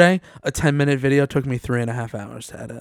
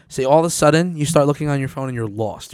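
normal talking voice

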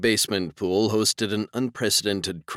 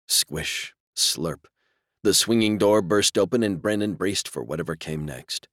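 plain reading voice

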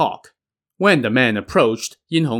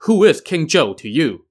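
higher-pitched, lightly stern challenge; mildly stern; 6 semitones higher, 3 dB louder than usual. A narrator's reading. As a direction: put a touch of sternness into the question. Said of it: "said sternly".

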